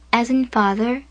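ordinary delivery

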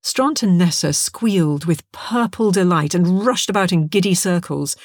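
usual speaking voice

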